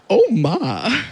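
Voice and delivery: put-on swooping Southern old-lady exclamation; Southern elderly lady. Toddler to his dad, scandalized Southern elderly lady accent. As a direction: imitate a toddler sounding like a Southern granny. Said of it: "like he's a Southern elderly lady".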